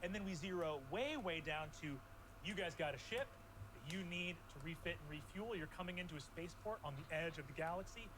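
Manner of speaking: building storytelling narration; game master scene setting. Acted. GM sets the new scene.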